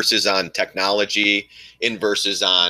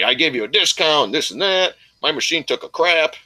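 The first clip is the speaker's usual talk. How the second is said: higher-pitched mimicry of lame excuses; mocking imitation; 5 semitones higher than usual. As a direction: parody his excuses in a raised voice.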